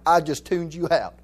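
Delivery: ordinary talk, nothing marked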